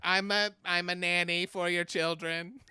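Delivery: loud, high alien nanny; Alien Miss Doubtfire. Acted. Comic topping friend's alien bits, Alien Miss Doubtfire voice. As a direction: pitch up into a silly nanny alien. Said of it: "I'll be Alien Miss Doubtfire".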